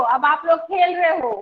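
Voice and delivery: ordinary talk, nothing marked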